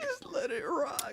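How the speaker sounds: squeaky high voice. Pretend. Repeated joke line, high.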